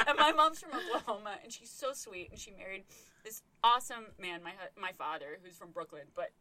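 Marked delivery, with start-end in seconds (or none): none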